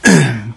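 clearing a rough throat. Real, not acted. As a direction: clear your throat mid-sentence. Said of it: "ببخشید".

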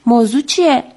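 usual speaking voice

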